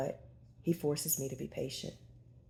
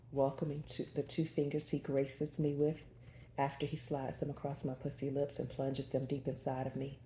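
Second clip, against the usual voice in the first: plain reading voice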